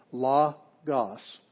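"logos" slowly split into LO, GOS; syllable by syllable. Shown as a demonstration. Teacher showing students the two syllables, "logos" said slowly in parts. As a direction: break "logos" in two with a gap between syllables. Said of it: "So it's two syllables".

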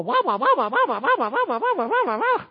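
mock the parent's endless whining with wah wah; mocking nagging wah. Preacher mocking a parent's endless nagging, high laughing wah wah. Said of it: "stop with the".

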